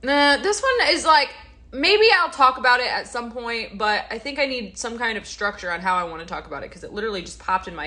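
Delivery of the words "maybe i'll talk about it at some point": ordinary talk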